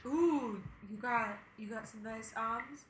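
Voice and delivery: sexy voice. Acted. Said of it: "my sexy voice".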